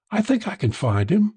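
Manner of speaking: high woman's character voice; woman calling back. A narrator's reading. Woman leaving to find Ed, calling back high. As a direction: pitch it up, calling back over distance. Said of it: "she called back".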